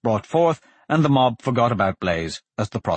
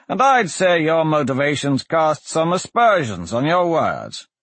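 lightly voiced magistrate, raised pitch falling at the end; dismissive magistrate; 6 semitones higher, 3 dB louder than usual. Magistrate doubts the prisoner's word, dismissive. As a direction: put the prisoner down from the bench.

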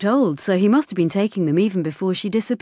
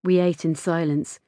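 ordinary delivery

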